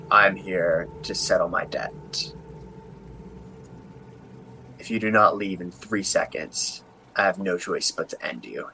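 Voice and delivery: low, soft, raspy threat. Acted. Hooded figure warning intruders to leave, raspy menace. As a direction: rasp out an ultimatum, measured and low. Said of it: "sort of in this raspy voice".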